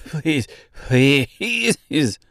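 winded, desperate plea; gasping. A narrator's reading. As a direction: beg between gulps of air. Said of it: "another gasp".

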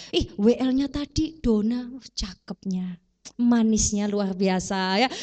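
slow, low, lingering mock leer; slow lecherous mock admiration; leering admirer. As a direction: savour the words like a lecher. Mimics lustful priest's son.

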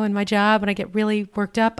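ordinary delivery

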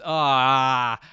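long, loud "ahh" of revulsion; disgusted groan. Real, not acted. Grossed out by the word membrane, disgusted groan. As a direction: groan it out, skin crawling.

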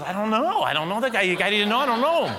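loud, high-pitched denial; bewildered protest. Retold or imitated. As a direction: protest that you have no idea, voice up. Son retold, protesting ignorance.

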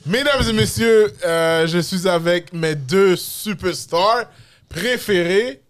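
loud, high, drawn-out introduction; show announcer. Real, not acted. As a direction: build up the stars like a ring announcer. (présentation d'animateur, forte et étirée)

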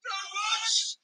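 pained cries, pitch up but level down; wounded man's strained cry; anguished cry. Acted. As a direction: cry out in pain and panic. Wounded man crying out.

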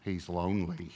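low and soft, with a half-laugh; mock-grave hush, as if revealing a secret; hushed, solemn quote. Whale expert softly delivering his verdict, hushed and mock-solemn. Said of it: "here's what the guy said".

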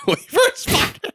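words squeezed out through laughter; laughing. Real, not acted. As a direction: laugh while repeating it. Repeats the joke laughing.

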